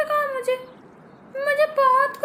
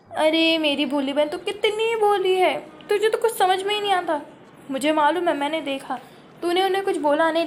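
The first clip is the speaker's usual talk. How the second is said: scheming elder sister; 10 semitones lower than usual. Acted. Elder sister revealing the laughing-powder prank, low and sly. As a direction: let her in on the prank.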